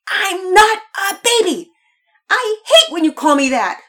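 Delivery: high, loud indignant yell; furious child. A narrator's reading. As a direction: shout back at the teasing brother. Mocked child shouts back.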